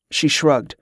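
usual speaking voice

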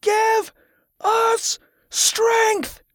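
very high, strained rallying shout; high-pitched, drawn-out war cry; roaring battle cry. A narrator's reading. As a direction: roar it out like a charging crowd. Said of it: "the pilgrims roared".

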